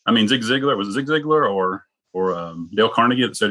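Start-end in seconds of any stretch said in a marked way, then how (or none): none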